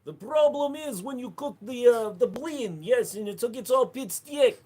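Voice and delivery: high put-on Russian accent. Streamer voicing the Russian spouse in a high Russian accent. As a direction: cook the blin like a Russian wife. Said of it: "Russian spouse bit".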